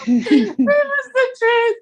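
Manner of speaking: high, half-laughing, half-crying; laugh-cry, slow and high; laughing through tears. Real, not acted. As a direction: let the laugh crack into tears. Laughing-crying at her own confession.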